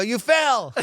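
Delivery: high, loud exasperated answer; frustrated. Retold or imitated. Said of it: "gotten a little frustrated".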